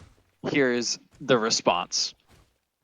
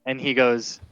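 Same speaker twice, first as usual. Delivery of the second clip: ordinary talk, nothing marked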